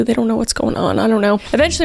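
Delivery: ordinary talk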